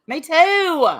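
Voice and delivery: high, loud burst of agreement; excited. Real, not acted. To a chat viewer from Appalachia, a loud excited agreement. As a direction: jump in eagerly.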